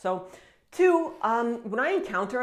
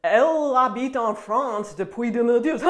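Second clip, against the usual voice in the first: loud, laughing parody of her French; mock her accent while laughing; laughing parody, louder; laughing mockery; 3 semitones higher, 7 dB louder than usual. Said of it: "a French person will say".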